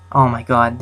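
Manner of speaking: normal talking voice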